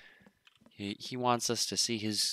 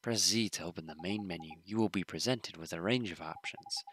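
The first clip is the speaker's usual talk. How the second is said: ordinary talk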